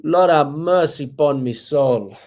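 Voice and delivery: drawn-out mock Jamaican accent; exasperated plea in a put-on Jamaican accent. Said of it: "That's my Jamaican accent".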